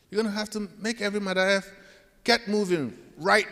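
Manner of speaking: high, loud barked order; angry boss. Retold or imitated. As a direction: bark the order at him. Supervisor's order re-enacted.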